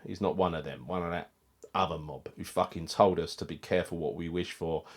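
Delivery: normal talking voice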